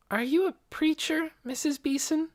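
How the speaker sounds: put-on high-pitched, curious girl's voice; young girl. A narrator's reading. Girl asking the woman about her work, high and curious. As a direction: ask it high, as a curious child.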